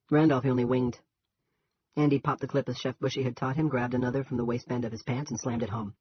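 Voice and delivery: ordinary delivery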